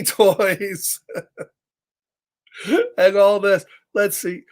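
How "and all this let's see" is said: ordinary delivery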